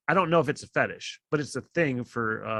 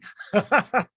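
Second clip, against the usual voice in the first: laugh; 8 semitones higher, 5 dB louder than usual. Real, not acted. Laughs at his own reply.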